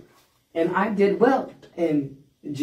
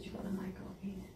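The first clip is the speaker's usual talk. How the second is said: very soft, quick, under the breath; hushed retold insult; 3 semitones higher, 20 dB quieter, faster than usual. Inmate retelling her insult to the lieutenant, hushed. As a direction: drop the punchline to near silence.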